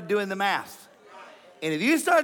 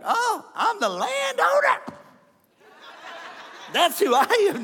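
much higher, slow, mock-grand claim; smug self-important listener; 11 semitones higher than usual. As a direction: puff yourself up as the landowner, half-laughing. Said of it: "some of you are like".

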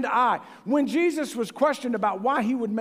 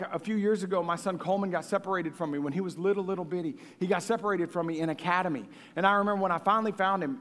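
ordinary talk, nothing marked

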